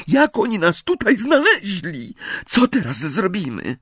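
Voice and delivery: high, panicky voice; hysterical questioning. A narrator's reading. Girl terrified that they were found, hysterical. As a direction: fire off questions in rising panic, pitched high. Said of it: "histerycznym głosem".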